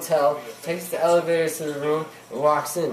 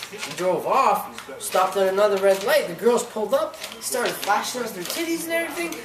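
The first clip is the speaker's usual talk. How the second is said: usual speaking voice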